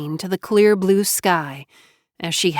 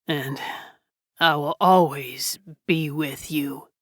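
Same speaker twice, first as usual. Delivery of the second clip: lower, softer voice of a dying man; dying Jonah, low and weak; 4 semitones lower, 4 dB quieter than usual. Acted. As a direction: speak low, fading with life. Dying man's farewell.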